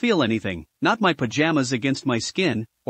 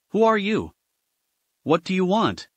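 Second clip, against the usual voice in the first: higher, mildly raised challenge; a half-hearted shout at the intruder; 4 semitones higher than usual. A narrator's reading. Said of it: "David shouted".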